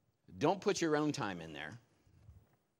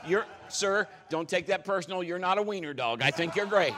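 calling to the dog; 5 semitones higher, 6 dB louder than usual. Real, not acted. Talking to Steve the dog.

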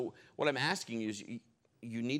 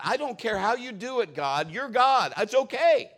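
excited; 11 semitones higher, 11 dB louder than usual. Retold or imitated.

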